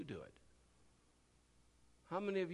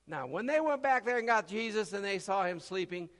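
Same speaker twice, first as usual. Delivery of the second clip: normal talking voice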